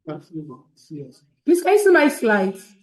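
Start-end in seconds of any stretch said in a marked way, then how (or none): 1.6-2.7 calling out. Real, not acted. Asking about slides on call.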